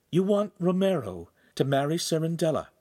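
pointed tone. A narrator's reading. Giulietta questioning her father, pointed and incredulous. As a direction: let disbelief sharpen the word want. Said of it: "with a certain tone in her voice".

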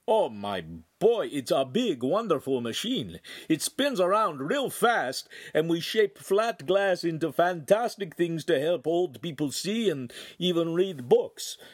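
an eager craftsman explaining his marvel; accented old craftsman. A narrator's reading. Master voiced with accent.

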